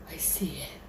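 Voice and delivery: very soft, whispered dread; frightened whisper. Retold or imitated. Telling a friend what is there, frightened whisper. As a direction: whisper it, eyes on the thing. Acting out seeing the thing.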